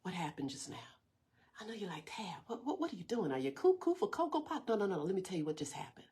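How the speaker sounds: unvoiced, hushed breath-voice; whispered. Pastor whispering to the congregation after the held breath. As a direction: whisper it so the room stays still.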